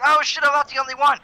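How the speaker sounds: high-pitched surprised impression; surprised cartoon voice. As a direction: play the swallowed guy in a high startled squeak. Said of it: "And he was like".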